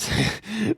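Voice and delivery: quick, real laugh jumping way up; high amused laugh. Real, not acted. Reviewer laughing at the rapper's weird lyric, high laugh.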